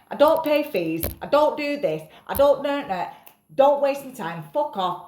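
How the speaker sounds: retelling how she brushed off a man; telling him off. Retold or imitated. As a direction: shut him down hard. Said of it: "I'm like".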